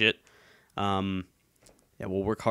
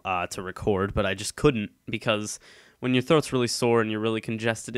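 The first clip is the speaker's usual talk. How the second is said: ordinary talk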